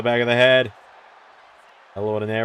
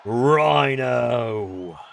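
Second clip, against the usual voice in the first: ring announcer. Acted. Introduces wrestler entrance.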